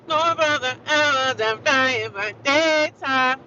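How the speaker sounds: loud, high, very slow declamation; hammed-up, drawn-out proclamation; mock-grand scripture recital. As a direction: stretch each word like booming scripture. Repeats the scripture performance.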